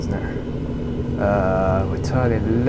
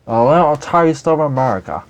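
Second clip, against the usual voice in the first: hammed-up American accent, louder; bad American accent; 5 semitones higher, 6 dB louder than usual. Shown as a demonstration. Signing off to his viewers in a bad American accent. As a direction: put on a terrible American accent for "A little taste of America". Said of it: "That was a terrible accent".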